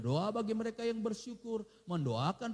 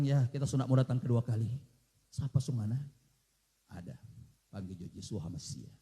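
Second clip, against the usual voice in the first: low, deep mock divine voice; a hint of a grave Jesus in Manado dialect; Jesus speaking; 13 semitones lower than usual. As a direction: lower the voice to play the Lord. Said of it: "Intonasi mesti pas ya".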